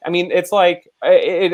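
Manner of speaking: ordinary delivery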